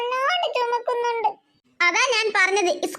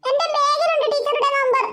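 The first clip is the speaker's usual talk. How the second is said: put-on higher child's voice, upset; sulky little girl; 4 semitones higher than usual. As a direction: play a small girl refusing school. Child Devu answers mother. (പിണങ്ങിയ കൊച്ചുകുട്ടിയുടെ ശബ്ദം)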